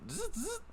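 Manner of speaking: imitated little power-tool buzz; buzzing tool noise. Game master mimics the droid loosening his pins, buzzing tool noise. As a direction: make two short quiet zzz buzzes.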